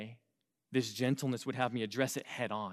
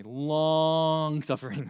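long suffering stretched very slowly and a bit louder; drawn out; 4 dB louder, much slower than usual. Shown as a demonstration. Pastor repeating the phrase to teens, drawn out. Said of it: "I say it like that intentionally".

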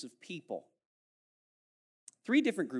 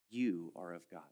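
softer and lower, calm assurance; reassuring; 8 semitones lower, 6 dB quieter than usual. Shown as a demonstration. Preacher gently reading verse four, softer and lower. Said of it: "calm reassuring reading".

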